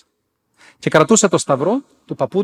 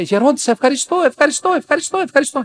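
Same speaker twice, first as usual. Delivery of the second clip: high-pitched, gushing imitation of gratitude; effusive thanks; 12 semitones higher than usual. Healed nun thanking the elder, effusive. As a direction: repeat it excitedly, pitched right up.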